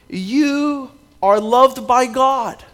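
each word drawn out, weight on loved; preacher's slow, deliberate scripture emphasis. Acted. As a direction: let "you are loved by God" land slowly, stressing loved. Preacher stresses scripture line.

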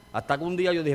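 ordinary delivery

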